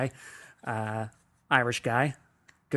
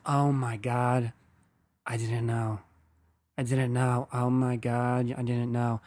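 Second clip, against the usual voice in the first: slow, drawn-out mock horror; mortified apology. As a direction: imitate someone slowly realizing their awful blunder. Acts out mortified joker apologizing.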